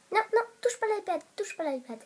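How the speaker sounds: quick, high startled no; sudden alarm. Real, not acted. Stopping the hamster from climbing the iPad, startled. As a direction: blurt it out as she grabs the iPad.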